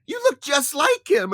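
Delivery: high put-on old lady voice; mock old lady gushing. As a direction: gush like a starstruck granny.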